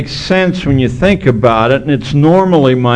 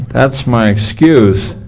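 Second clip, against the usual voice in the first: ordinary talk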